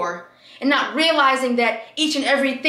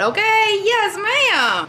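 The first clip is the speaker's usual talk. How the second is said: sassy; 10 semitones higher, 4 dB louder, much slower than usual. Real, not acted. Hyping herself to viewers.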